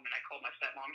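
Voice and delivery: usual speaking voice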